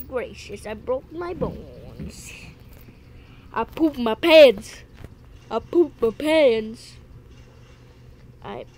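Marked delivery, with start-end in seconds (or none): 3.5-6.9 a slow, much louder joking yell; mock yell. Gamer joking after breaking his bones, slow loud mock yell. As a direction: bellow the silly line slowly for laughs. Silly joke yelled while playing.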